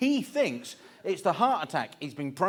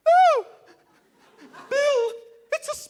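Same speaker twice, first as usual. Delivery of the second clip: piercingly high hammed-up scream; panicked shriek; 10 dB louder than usual. Son-in-law terrified of a spider, screaming to father-in-law. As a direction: shriek in terror, far above normal pitch. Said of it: "I look at him into those harsh East End eyes and I go".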